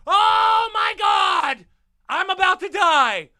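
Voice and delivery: loud, high and very slow terrified cry; panicked lady. Retold or imitated. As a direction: play the woman in front of the car shrieking in fear. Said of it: "there's a lady going".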